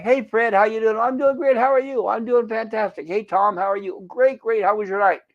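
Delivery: high, bright-pitched chirpy coworker chatter; jovial office greetings. Retold or imitated. Coworkers cheerfully greeting each other in the office, high and chirpy. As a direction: play every coworker as cheery and upbeat. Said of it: "they're all happy and jovial".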